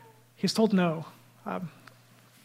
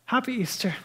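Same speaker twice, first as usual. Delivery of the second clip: ordinary talk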